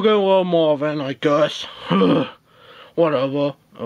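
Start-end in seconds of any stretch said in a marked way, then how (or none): 1.7-2.3 low, breathed-out sigh; resigned sigh. Acted. Teammate giving in on the vote, resigned sigh. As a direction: let out a sigh of reluctant agreement.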